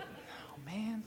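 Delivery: soft, breathy under-the-breath sigh; quietly overwhelmed at the idea; whispered dread. Retold or imitated. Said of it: "I was like".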